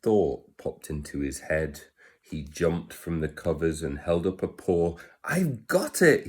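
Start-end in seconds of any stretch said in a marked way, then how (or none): 5.2-6.3 squeaky, higher little mouse. Acted. Mouse announcing his idea, high and eager. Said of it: "he said".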